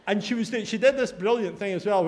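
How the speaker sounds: usual speaking voice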